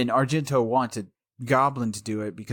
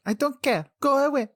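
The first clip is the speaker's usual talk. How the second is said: high, curt brush-off; brisk starchy housekeeper. Retold or imitated. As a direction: dismiss her like a stern housekeeper. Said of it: "I sounded like, like an, a housekeeper".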